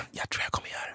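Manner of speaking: whispered, barely voiced; hushed reply. Answering his friend who dares him, hushed whisper. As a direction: whisper it under your breath.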